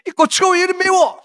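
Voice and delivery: high-pitched mock complaint; exaggerated indignant griping; complaining. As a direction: imitate a grumbler, voice pushed way up. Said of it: "하고 원망하는 사람이 있어요".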